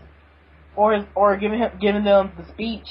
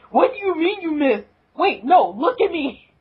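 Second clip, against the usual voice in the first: higher, indignant retort; a hammed-up annoyed protest; mock outrage; 6 semitones higher than usual. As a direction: sputter at the smartass. Angry reply to a smartass.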